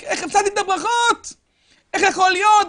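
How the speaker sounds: high anguished cry of loss; Esau's bitter cry. Acted. Preacher voicing Esau's bitter cry over lost blessings, anguished high shout. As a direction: cry out like a man robbed of his blessing. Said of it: "מה הוא צועק?".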